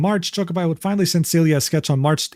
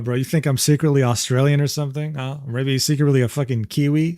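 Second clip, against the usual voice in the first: normal talking voice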